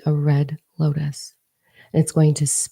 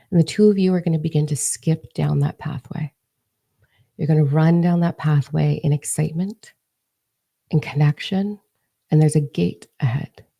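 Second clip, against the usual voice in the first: normal talking voice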